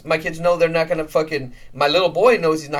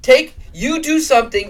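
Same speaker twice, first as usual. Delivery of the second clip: exasperated rant; 8 semitones higher, 4 dB louder than usual. Real, not acted. Ranting at excuse-makers.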